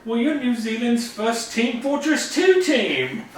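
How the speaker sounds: slow, high-pitched New Zealand caricature; New Zealand accent. Acted. Host explaining things to the baffled team, put-on New Zealand accent. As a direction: draw it out in a high Kiwi accent. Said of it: "doing my best New Zealand accent".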